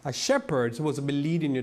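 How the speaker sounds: ordinary talk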